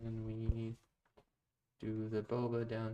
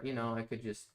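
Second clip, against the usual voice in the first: ordinary talk, nothing marked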